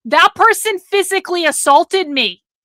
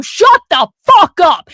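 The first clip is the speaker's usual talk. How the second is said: loud, forceful retold shout; loud shout of shut the fuck up at a drunk heckler; angry shout; 3 semitones higher, 7 dB louder than usual. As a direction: get in his face and shut him up. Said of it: "tell you".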